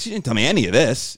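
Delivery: ordinary talk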